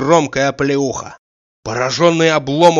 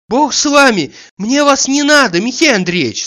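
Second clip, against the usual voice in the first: much higher female figure; offended hostess; 10 semitones higher, 4 dB louder than usual. A narrator's reading. Hostess disowning her unwelcome guest, high offended voice. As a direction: dismiss the guest in a high, protesting voice. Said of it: "сказала Агафья Матвеевна".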